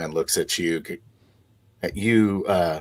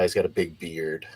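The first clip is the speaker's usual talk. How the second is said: ordinary talk, nothing marked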